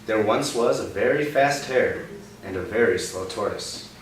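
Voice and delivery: bright read-aloud for a little one; storybook reading. Acted. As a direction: tell it like a picture-book story. Reading picture book to child.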